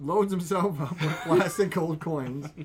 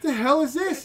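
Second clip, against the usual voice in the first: mock outraged stripper; 8 semitones higher, 6 dB louder than usual. Stripper reacting in retold story.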